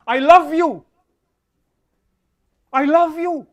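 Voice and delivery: loud, high "I love you"; big, forceful profession of love; emphatic declaration. Shown as a demonstration. Models saying it out loud, emphatically.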